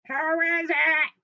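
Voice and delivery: loud, high, stretched fake granny; wolf posing as Grandma. Wolf in Grandma's bed answers, loud and high. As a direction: belt it out in a bad granny voice.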